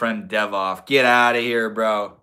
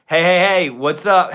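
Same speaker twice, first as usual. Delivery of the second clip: loud greeting; 6 semitones higher, 7 dB louder than usual. Real, not acted. Greeting viewer loudly.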